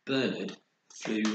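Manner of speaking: ordinary delivery, nothing marked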